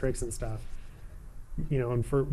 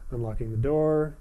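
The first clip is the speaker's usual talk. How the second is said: ordinary delivery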